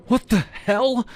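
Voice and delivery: high, loud shocked cry; startled scream. Retold or imitated. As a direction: shriek it in shock. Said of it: "I screamed".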